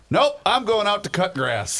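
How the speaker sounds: high, laughing punchline delivery; gruff manager quote. Retold or imitated. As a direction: announce it like a blunt old coach. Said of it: "And he says".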